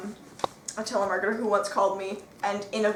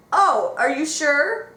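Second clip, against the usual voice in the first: slow, higher mock surprise; caller's surprised question; 5 semitones higher, 4 dB louder, much slower than usual. Retelling a scam caller's reply, slow and higher. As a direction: draw out the caller's doubt. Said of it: "He goes".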